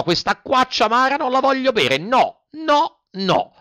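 put-on very high childish tantrum; tearful Pinocchio. A narrator's reading. Puppet bursts into tears refusing medicine, acted tantrum. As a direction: wail the refusal, loud on each no. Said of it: "gridò Pinocchio dando in uno scoppio di pianto".

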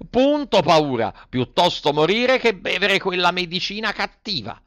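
put-on high, stubborn puppet boy; defiant Pinocchio. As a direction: declare it in a high childish voice.

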